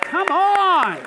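1 rousing call. Real, not acted. Urging applause for Jesus.

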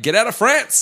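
yelled high, panicky cry to flee; panicked French crowd. Retold or imitated. Fleeing French villager yelling at neighbours, panicked. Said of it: "everyone's just like".

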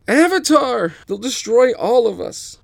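high, loud panicked scream; fleeing firebenders screaming in fear. A narrator's reading. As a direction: shriek as you flee.

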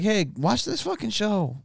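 high-pitched imitation of a gushing fan; hammed-up high voice of a fan; enthusiastic fan plug. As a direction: pitch it up, recommending the show to a friend. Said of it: "be like".

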